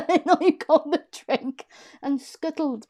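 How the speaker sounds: laughing through it. Real, not acted. Laughing while telling.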